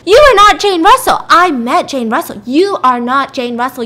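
loud acted yell, starting high; outraged denial. Woman confronting supposed Jane Russell, loud outraged denial. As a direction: burst out with loud indignant denial.

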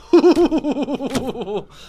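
long, fluttering high-pitched whoop; wavering high hoot. Real, not acted. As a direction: let out a long, tremulous ooh. Reacting to the first date question.

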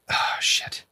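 whispered curse. Real, not acted. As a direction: breathe the curse out. Stomach cramps.

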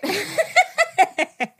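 high laugh, out of breath; giggling, winded; breathless laugh. Real, not acted. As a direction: laugh it off after gushing. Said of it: "I'm out of breath now".

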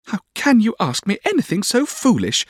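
put-on very high, girlish pout; childish high-pitched sulk. A narrator's reading. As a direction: protest like a spoilt sweetheart. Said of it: "pouted Dora".

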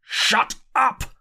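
high, urgent, panicked command; terrified. A narrator's reading. Narrator silencing curate lest the Martians hear, high terrified order. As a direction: silence him before you are heard. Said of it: "in a terror lest the Martians should hear".

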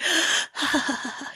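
a breathy, hammed-up gasp of delight; airy imitated gasp; mock gasp. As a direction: gasp like you're starstruck. [name] reacts to Keanu.

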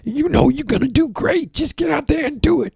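high falsetto boss giving a pep talk; mock pep-talk boss. Mocking the booker's pep talk in a high falsetto. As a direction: squeak it out like a fake-encouraging boss. Boss's reassurance, mocked voice.

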